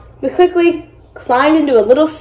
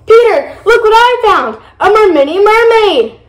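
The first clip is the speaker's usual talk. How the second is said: high-pitched excited announcement; eager, raised-voice shout to a brother; excited calling; 9 semitones higher, 4 dB louder than usual. A narrator's reading. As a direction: call out to show off a find. Child excitedly calls brother to see.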